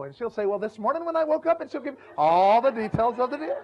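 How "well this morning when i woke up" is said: higher, chatty wife launching into her day; a wife starting to tell her husband about her day, higher; imitated wife. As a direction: start a long story like an eager wife.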